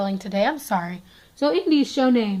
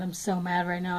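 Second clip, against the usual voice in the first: ordinary talk, nothing marked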